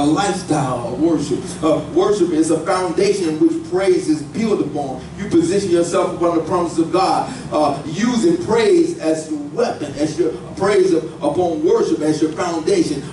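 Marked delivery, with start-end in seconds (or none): none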